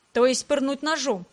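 ordinary delivery, nothing marked

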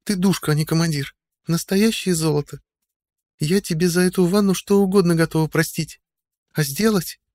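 put-on high, playful young woman; playful girl voice. A narrator's reading. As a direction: tease him sweetly, girlish. Audrey voiced in bath.

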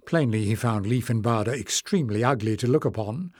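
usual speaking voice

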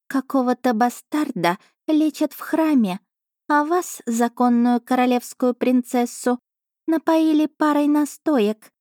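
high, slow contemptuous cat voice; scornful cat familiar. A narrator's reading. Cat familiar scorning the duke, high contemptuous voice. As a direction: sneer like a royal cat. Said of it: "презрительно фыркнула она".

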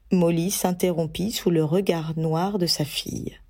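usual speaking voice

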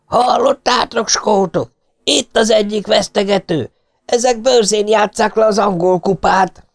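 bearded fan roaring to the Scots about a briber; roaring shout. A narrator's reading. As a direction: whip up the crowd against the briber. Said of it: "A szakállas felüvöltött".

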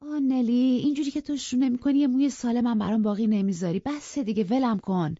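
put-on girl crossly pushing her maid away; irritable. A narrator's reading. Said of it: "با اوقات تلخی".

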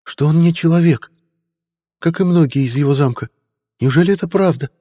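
high eager squire's voice; young boy voice. Acted. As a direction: play the nervous teen squire.

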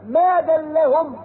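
Quran recitation. Acted. Preacher reciting to the congregation, slow chant. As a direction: draw the words out in chant.

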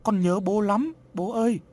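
put-on high, pleading child's cry; grieving child's plaintive call; crying out loudly. A narrator's reading. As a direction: voice a child wailing for a parent, pitch lifted. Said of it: "khóc lớn".

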